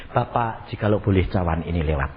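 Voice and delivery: soft, low, quick prayer; subdued, low-pitched supplication; Jesus praying. Acted. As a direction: quietly plead with the Father in a low voice. Acts Jesus praying in Gethsemane.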